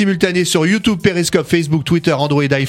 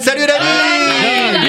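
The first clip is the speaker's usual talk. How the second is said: cheering; 10 semitones higher, much slower than usual. Real, not acted. Cheering for sick colleague.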